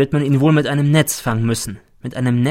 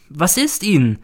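higher-pitched, alarmed question; startled; 6 semitones higher than usual. Acted. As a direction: ask it with a start. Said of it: "Sie erschrickt sehr". (erschrocken, mit höherer Stimme)

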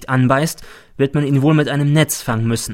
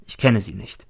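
usual speaking voice

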